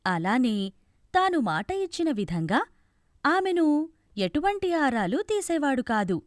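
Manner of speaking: plain reading voice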